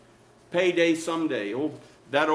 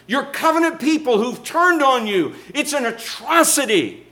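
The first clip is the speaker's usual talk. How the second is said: high, raised-voice indignant plea; crying out to God; 7 semitones higher than usual. Acted. Prophet demanding that God punish Judah, crying out. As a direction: cry out in righteous protest, pitched high. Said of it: "he's crying out to God".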